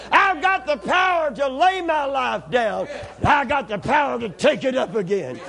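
rising, ringing pulpit cries building to shouts; full-force preaching. Acted. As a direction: preach it to the rafters, driving each line home.

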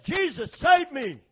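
high, loud, drawn-out plea; crying out. Acted. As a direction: cry out for rescue, acting it out. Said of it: "And he cried out".

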